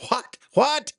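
high squeaky scandalized voice; mock shocked friend. Friend scolding Brewer about the balls joke, high and squeaky. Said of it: "He said".

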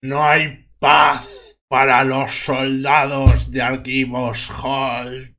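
very slow, solemn voice from the grave; undead voice. Acted. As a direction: intone it like a cold corpse. (voz lenta de ultratumba)

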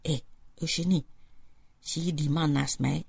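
zacht en vertrouwelijk, iets stiller; op zachte toon. Acted. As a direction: fluister het bijna naar je vriendin. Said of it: "zegt zij op zachte toon". (zacht, samenzweerderig)

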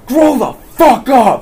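loud, high, drawn-out holler; imitated angry yell. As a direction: act an older man bellowing from his car. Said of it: "he was an older guy, he leans out his window and goes".

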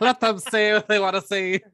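loud, high Irish-sounding lilt; hammed-up Irish proclamation; accidental Irish accent. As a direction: slip into a mock Irish voice. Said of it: "I don't know why it sounded Irish".

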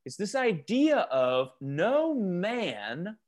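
man leaned on and slowed; stress on man. Shown as a demonstration. Teacher stressing man to his Bible study group. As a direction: land hard on man, no mere mortal. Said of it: "emphasis on the word man".

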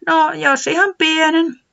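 high, slow little voice; Tiputi agreeing. Acted. Tiputi shyly accepting a carrot, high and slow. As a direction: give in shyly in a small high voice. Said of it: "Tiputi myöntyi".

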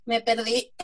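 normal talking voice

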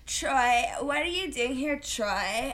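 mocking, drawn-out imitation of an actress saying Troy; weird breathy Vanessa impression. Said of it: "she says it really weird".

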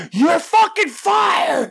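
high, drawn-out mock shout; hollering out the window. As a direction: holler it down at someone in the alley. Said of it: "just holler out the window".

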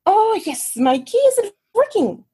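high-pitched tipsy Swedish lady; Swedish accent. Retold or imitated. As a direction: gush in a hammed-up Swedish accent. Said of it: "try to do a Swedish accent".

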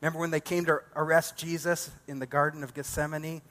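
usual speaking voice

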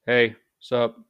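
loose, friendly slang hello; slangy casual greeting. Shown as a demonstration. Greeting a friend, relaxed and slangy. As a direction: greet a buddy offhand. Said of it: "we can say".

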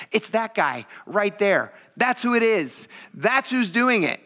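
high, slow, emphatic pointing-out; single out the culprit, slowly and pointedly; blunt accusation. Retold or imitated. Acting Jesus openly pointing out Judas.

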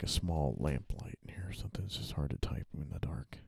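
hushed, voiceless grumble to himself; whispered aside. Real, not acted. Writer alone in the dark, whispering complaints. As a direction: whisper it so no one hears.

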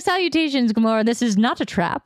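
sweet posh British trap voice. Retold or imitated. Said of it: "she's like".

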